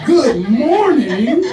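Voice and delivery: loud, high, drawn-out questioning echo; incredulous. Real, not acted. Comedian throwing the greeting back, loudly incredulous. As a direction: stretch each word in disbelief, rising at the end.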